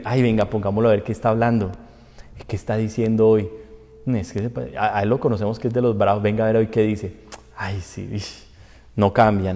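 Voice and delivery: low, soft aside between churchgoers; mock gossiping viewers. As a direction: imitate members quietly sneering at the stream. Acts out viewers gossiping over the broadcast.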